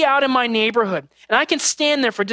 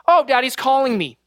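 higher-pitched imitation of a kid; lightly voiced child; 4 semitones higher than usual. Child hearing dad yell across the neighborhood, lightly imitated. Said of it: "they'll hear the tone of my voice".

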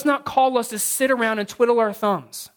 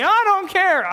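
put-on higher, defiant child snapping back; bratty kid retort; 8 semitones higher, slower than usual. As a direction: toss it off like a bratty boy. Son's retort, high voice.

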